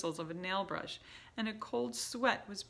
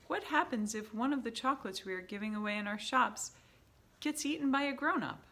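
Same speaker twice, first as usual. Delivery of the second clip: a lightly voiced witch asking, higher at first; timid witch asking; 5 semitones higher, 5 dB louder than usual. A narrator's reading. Audience witch questioning the leader, lightly voiced. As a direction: ask carefully, stumbling on the title. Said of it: "said a voice in the audience".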